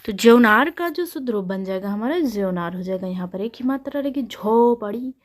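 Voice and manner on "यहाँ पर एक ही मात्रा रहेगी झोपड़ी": usual speaking voice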